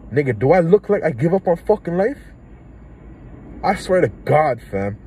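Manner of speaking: angry outburst. Real, not acted. Answering people who tell him to grow up, angry and loud. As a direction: fire it back at the critic, furious. Angry reply to imagined critic.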